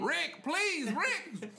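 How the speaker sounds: high, loud mock plea with a laugh; desperate pleading. Companion begging the raging man to stop, hammed-up pleading. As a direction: beg him in an exaggerated panicked cry.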